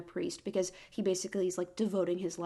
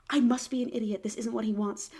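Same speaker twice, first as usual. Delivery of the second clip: ordinary delivery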